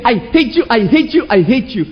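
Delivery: high, bitter I hate you; scolding yourself after sinning, pitched high; self-hatred. Retold or imitated. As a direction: spit it at yourself. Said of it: "you say".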